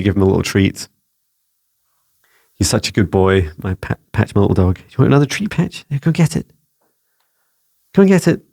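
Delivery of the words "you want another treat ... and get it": higher-pitched, playful coaxing for a pet; talking to a dog. Real, not acted. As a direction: urge the dog on to fetch its treat. Coaxing his dog with a treat.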